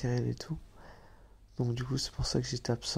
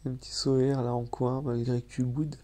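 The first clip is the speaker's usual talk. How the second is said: ordinary delivery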